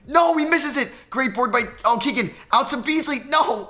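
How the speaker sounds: high, loud, rushed calling; frantic play-by-play. Real, not acted. Gamer calls a frantic late possession, high and loud. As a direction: call the scramble in panic.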